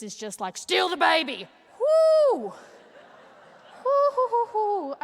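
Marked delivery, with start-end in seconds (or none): 0.6-1.5 sudden high shout, greedy and loud; uterus shouting. Pretend. Uterus yelling at a baby on the street. 1.8-4.9 high, loud whooping hoots; wild whoop. Acted. Comic whooping after the baby-stealing bit.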